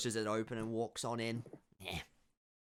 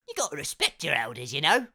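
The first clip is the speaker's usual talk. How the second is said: lightly voiced grumpy elder; 4 semitones higher, 8 dB louder than usual. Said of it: "he sort of just goes".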